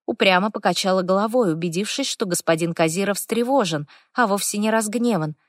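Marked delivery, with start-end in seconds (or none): none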